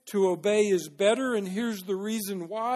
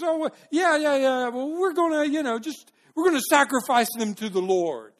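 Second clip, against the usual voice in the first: high, loud, stumbling excuse; improvised quick lie; 7 semitones higher, 6 dB louder than usual. Acted. Saul lying quickly to Samuel, high flustered excuse-making. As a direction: scramble for a cover story, tripping over the start. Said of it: "he thought of the quickest lie he could".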